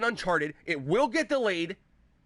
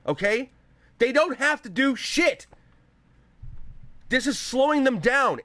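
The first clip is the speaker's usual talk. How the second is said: angry rant; 4 semitones higher than usual. Real, not acted. Streamer ranting to viewers about console sales, angry. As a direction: hammer each point home in real anger.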